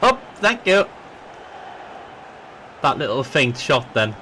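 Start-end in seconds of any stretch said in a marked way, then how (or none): 0.0-0.8 high delighted cry; thrilled thanks; excited. Real, not acted. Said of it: "That got me really excited".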